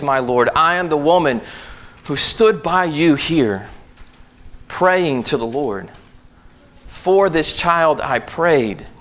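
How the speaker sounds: preaching scripture. Acted. Pastor proclaiming Hannah's vow to the congregation, preaching. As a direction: drive the story home to the pews.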